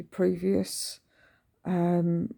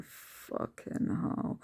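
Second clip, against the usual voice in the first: 低声咒骂，几乎无声，慢吞吞; whispered curse; 9 dB quieter, much slower than usual. Retold or imitated. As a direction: breathe the curse out slowly, no voice. Muttering frustration at early appointment.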